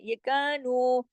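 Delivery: "कानू" with both vowels drawn out slowly; recitation demo. Shown as a demonstration. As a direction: stretch "कानू" two beats each. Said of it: "दोनों बराबर खींचेंगे".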